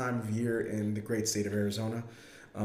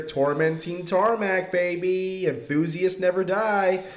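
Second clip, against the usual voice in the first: loud, slow, drawn-out catchphrase; hype tagline; 7 semitones higher, 6 dB louder, slower than usual. Acted. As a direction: punch out the show slogan. Show tagline shout-out.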